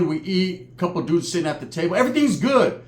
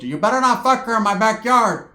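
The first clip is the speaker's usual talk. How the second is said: louder, higher mock telling-off; hammed-up auntie warning; scolding aunt; 5 semitones higher, 3 dB louder than usual.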